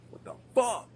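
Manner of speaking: shocked outburst. Real, not acted. Sudden shock at game.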